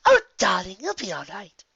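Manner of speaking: high, refined, soothing; comforting Rarity. Acted. Rarity comforts terrified Fluttershy, high and gentle. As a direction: reassure her gently.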